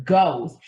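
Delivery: "ghost" punched loud with a falling tone; stress on ghost. Real, not acted. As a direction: hit "ghost" hard, as a final verdict. Restates the word for emphasis.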